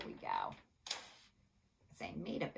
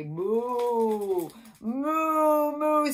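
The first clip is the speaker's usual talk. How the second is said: loud, long-drawn moos, rising higher; cow moo; 7 semitones higher, 13 dB louder, much slower than usual. A narrator's reading. Teacher showing children what a cow says, loud mooing. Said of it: "What does a cow say?".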